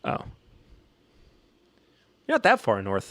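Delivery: ordinary delivery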